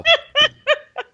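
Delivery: real high-pitched giggling laugh. Real, not acted. Co-host cracking up at the porn star names remark, high laughter. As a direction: burst out in high, helpless laughter. Reacts to the absurd sentence.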